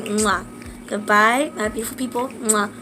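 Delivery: ordinary talk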